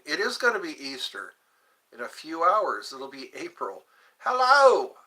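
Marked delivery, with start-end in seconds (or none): 4.2-5.0 high, loud hello; loud, bright greeting. Real, not acted. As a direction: call out to the viewer. Greeting a returning viewer.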